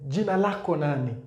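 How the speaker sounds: "Jina lako nani?" quick and fluent; quick Swahili demo. Shown as a demonstration. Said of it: "So ako here is your".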